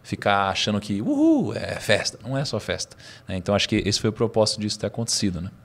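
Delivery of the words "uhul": high-pitched party whoop; put-on celebratory cheer; mock cheer. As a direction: whoop it like a carefree reveller. Mocks naive party mood.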